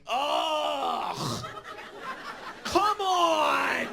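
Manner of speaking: hammed-up exasperated outburst; exasperated roommate. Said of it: "this is his response".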